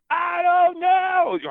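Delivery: loud, very high, slow rock-singer cry; high mock rock wail. Repeats it as [name] tribute song.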